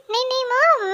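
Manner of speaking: high-pitched flustered protest; alarmed, pleading denial; hasty placating denial. Acted. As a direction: backpedal anxiously to your mother. Son hurriedly placates his angry mother.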